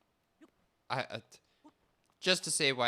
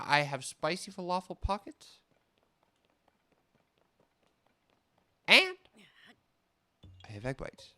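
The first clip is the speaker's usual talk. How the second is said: exasperated; 8 semitones higher, 5 dB louder than usual. Retold or imitated. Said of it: "third weary reply".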